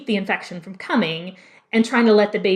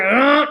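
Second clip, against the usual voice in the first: a louder imitated labor grunt, pushing; full grunt; 3 semitones higher, 4 dB louder than usual. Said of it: "the grunts coming full force".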